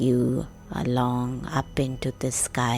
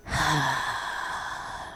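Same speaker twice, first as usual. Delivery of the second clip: long, airy relaxing sigh; deep breath out; 5 dB quieter than usual. Shown as a demonstration. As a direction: let out a slow, demonstrative exhale. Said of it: "Take a deep breath".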